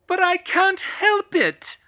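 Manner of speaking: high, loud and slow cry; cried out. Acted. Hermione crying out to George. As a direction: wail it out. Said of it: "she cried".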